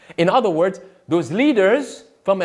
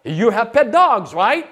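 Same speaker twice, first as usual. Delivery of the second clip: higher, breezy sneer; mocking paraphrase; 6 semitones higher than usual. Mocking the ministry leaders for his audience, breezy and higher. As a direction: mimic their glib sales pitch. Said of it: "they are positioning themselves and say".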